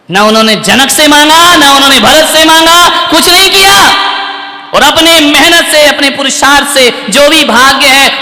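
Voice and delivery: loud, rising declamation; preaching at full force. Real, not acted. Preacher declaring Ram asked no one, full force.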